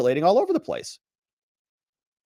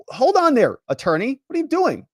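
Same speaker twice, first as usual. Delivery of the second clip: sharp, higher and louder judge; the judge snapping at the lawyer; stern rebuke; 7 semitones higher, 5 dB louder than usual. Retold or imitated. Judge scolding the defense attorney, sharp and high.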